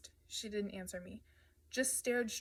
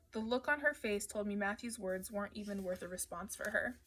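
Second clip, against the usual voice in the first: plain reading voice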